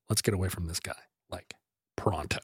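whispered urgent aside to get away; low urgent whisper so the guy won't hear; hushed whisper. Retold or imitated. Friend whispering to narrator to leave the party, hushed. Friend quietly urges leaving.

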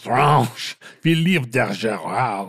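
over-the-top French accent on "Philippe de Gerard"; exaggerated French accent. Shown as a demonstration. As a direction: say "Philippe de Gerard" as "filiv tɛ ʒɛrɑr", a rolled French r and a heavy French lilt. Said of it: "You're saying it with a French accent, but with another French accent on top".

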